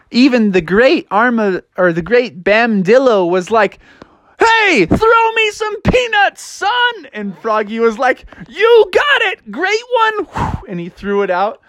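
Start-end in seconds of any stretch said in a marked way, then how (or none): 4.4-7.1 high, drawn-out holler; hammed-up big-shot shout, pitched high; star player hollering. Star player calls to Froggy. 8.5-10.6 high, stretched-out cheer; gleeful high frog holler; thrilled frog. Acted. Froggy answers his hero.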